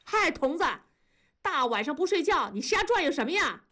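high-pitched hollered greeting; calling from afar. A narrator's reading. Neighbour cycling home spots his friend, calling out. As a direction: hail him with a lifted voice. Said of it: "老远就嚷嚷".

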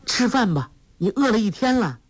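high-pitched character voice, urging. A narrator's reading. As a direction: coax him to eat in a high voice. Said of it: "黄蓉又说". (高声调地劝他吃饭)

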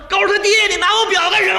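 high, fast, loud yell; shouted cry. Acted. Wife calls after husband who snatched her watch, shouted cry. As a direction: yell after a fleeing mugger in alarm. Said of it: "就听后边喊".

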